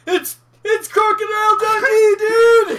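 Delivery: high, very loud hammed-up cry; mock excited shout. Co-host jumping into the tent story, mock excited shout.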